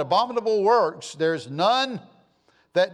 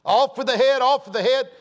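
raised, louder call of a king's order; mock royal decree; 6 semitones higher, 5 dB louder than usual. Preacher voicing a king's order, loud mock decree. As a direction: bark it out like a tyrant king.

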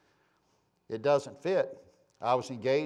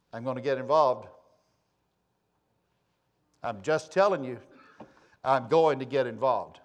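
ordinary talk, nothing marked

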